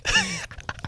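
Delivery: put-on high cackle; sarcastic laugh. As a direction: giggle in sarcastic disbelief. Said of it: "I'm being sarcastic and whatnot".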